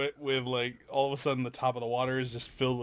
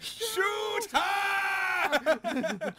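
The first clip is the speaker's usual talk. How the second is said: shouting. Retold or imitated. Mimicking guards in the game.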